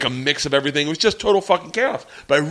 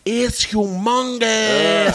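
slow mock awed girl; 5 semitones higher, much slower than usual. Imitating girl's verdict.

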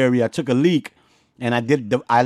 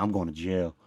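lower, softer repeated dread; quiet panic; 6 semitones lower, 9 dB quieter than usual. Retold or imitated. Second cop car arrives, he panics under his breath. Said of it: "I was like".

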